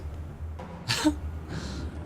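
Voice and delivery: breathy embarrassed little laugh; quiet laugh. Real, not acted. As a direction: laugh softly at your own slip. Laughs at own misreading.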